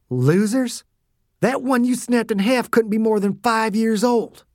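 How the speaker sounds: outraged and high, without shouting; indignant outrage. A narrator's reading. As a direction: throw the word back, then protest hotly. Dew outraged at child's killing.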